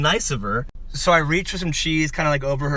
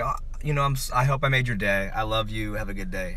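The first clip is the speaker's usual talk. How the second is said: soft acted goodbye, sinking lower; tender reassurance; 5 semitones lower, 5 dB quieter than usual. Shopper letting the crying lady go, tender mock reassurance. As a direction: soothe softly, trailing down to a gentle farewell.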